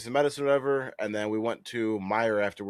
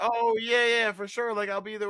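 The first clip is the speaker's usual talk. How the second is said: playing along; 12 semitones higher than usual. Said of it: "I'm like".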